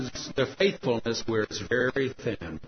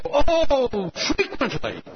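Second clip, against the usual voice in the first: high, loud "oh" dropping to a casual answer; hammed-up French lover's punchline; Pierre; 10 semitones higher, 5 dB louder, much slower than usual. French husband answers his wife's question about faithfulness, exuberant then offhand. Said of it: "you know how the French can be".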